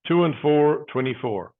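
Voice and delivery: steady sing-song number calling; bingo caller. Acted. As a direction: call each number out, then repeat it.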